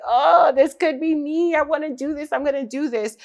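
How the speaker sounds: high, dreamy "this could be me"; light mock excitement at a new dream; excited daydream. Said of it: "I was like".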